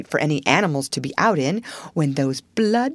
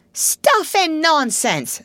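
slow, high-pitched indignant outburst; very angry; 8 semitones higher, much slower than usual. A narrator's reading. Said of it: "said Toad very angrily".

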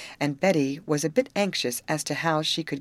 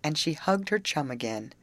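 ordinary delivery

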